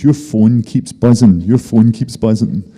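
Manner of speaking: imitating wife's complaint in a Scottish accent; slow, insistent Scottish voicing of a nudge in bed. Wife waking husband about his phone, in Scottish accent. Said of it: "She doesn't have a Scottish accent".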